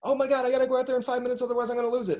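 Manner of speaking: high, very fast panicked babble; mock panic. Host imitates people racing out for five minutes, mock panic. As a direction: rush it in a hammed-up panic. Acted panicked reaction to break.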